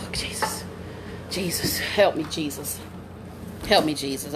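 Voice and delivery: low, soft appeal to Jesus; sigh, then pray under your breath; spent muttered plea. Real, not acted. Woman recovering from the scare, spent muttered plea. Catching breath, praying for help.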